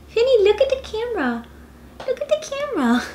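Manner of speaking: put-on high coaxing baby talk to a dog; high pet-talk voice.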